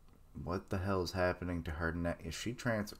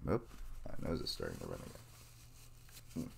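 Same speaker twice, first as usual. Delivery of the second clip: ordinary delivery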